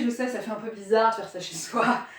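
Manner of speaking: ordinary talk, nothing marked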